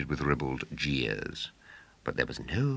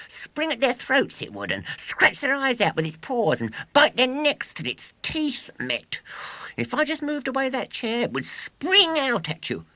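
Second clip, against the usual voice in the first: put-on high, loud boastful showman boy; 7 dB louder than usual. A narrator's reading. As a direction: crow it like a swaggering boy showman. William boasting to audience.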